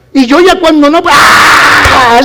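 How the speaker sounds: a real shout, voice jumps up loud; cheering shout. Retold or imitated. Father recreating his cheering at the basketball game, loud excited yell.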